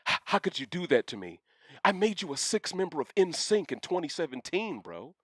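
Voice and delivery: a hint of a hurt, whiny juror; salty juror. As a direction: play it wounded and petty. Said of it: "how salty [name] would be".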